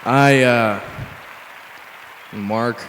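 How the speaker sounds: reluctant, halting self-introduction; hesitant, joyless roll-call answer; unenthusiastic. Acted. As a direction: give your name with no enthusiasm at all. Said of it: "show a little enthusiasm".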